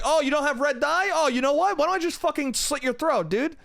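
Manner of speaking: high-pitched, worked-up sarcasm; mock threat. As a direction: act out an exasperated, over-the-top threat. Acted threat to the annoying clerk.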